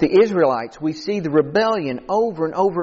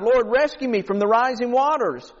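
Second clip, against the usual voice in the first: higher-pitched acted plea; imitated crying out; 7 semitones higher than usual. Trapped man prays from the roof, imitated crying out. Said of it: "he's crying out to God".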